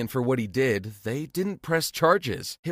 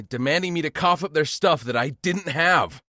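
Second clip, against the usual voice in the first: ordinary delivery, nothing marked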